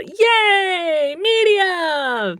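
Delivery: high mock cheer. Cheering for herself as peanut gallery, excited.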